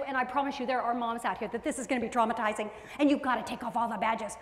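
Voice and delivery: ordinary talk, nothing marked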